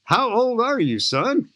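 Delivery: a hint of an amused veteran broadcaster; kindly old announcer quizzing a young skydiver, mildly impressed; Jack Buck asking. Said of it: "So Jack Buck's interviewing me".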